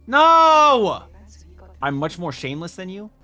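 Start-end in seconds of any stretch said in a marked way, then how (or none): 0.1-1.0 sudden high, loud shout of protest; real shout. Real, not acted. Viewer yelling at the screen, high loud no. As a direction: yell at the screen in shock. Shocked at drama twist.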